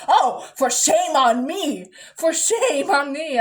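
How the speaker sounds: loud, slow, drawn-out lament; mock self-reproach. Mom learning she bought Sunny D, theatrical self-reproach. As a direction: declaim it as hammed-up shame.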